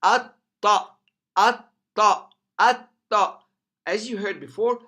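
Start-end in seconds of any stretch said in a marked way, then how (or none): 0.0-3.4 AT TA with a held, loud double t; doubled ta. Shown as a demonstration. Teacher puts shadda on the ta, doubled and forceful. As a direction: stop on the t of AT, then punch TA. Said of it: "put shadda on the ta".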